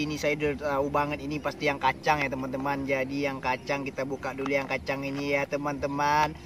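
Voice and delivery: ordinary delivery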